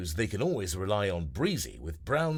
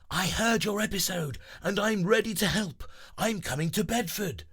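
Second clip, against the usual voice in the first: Breezy shouting; 11 semitones higher than usual. Acted. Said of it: "Breezy shouts".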